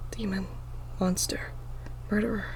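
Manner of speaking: hushed, menacing whisper of accusing voices; eerie whispers. A narrator's reading. Unseen voices whispering accusations at the frightened girl. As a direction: breathe the words like haunting whispers. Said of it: "I hear whispers behind me".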